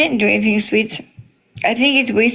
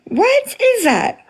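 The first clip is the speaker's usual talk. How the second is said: incredulous; 11 semitones higher, slower than usual. Real, not acted. Indignant at prayer then name-calling.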